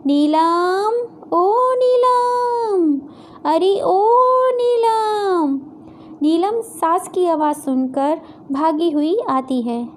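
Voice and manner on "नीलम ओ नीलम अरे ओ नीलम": high, long drawn-out call; sing-out holler, pitched well up. Acted. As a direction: summon someone from another room, stretching the name. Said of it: "नीलम को आवाज़ लगाती हैं".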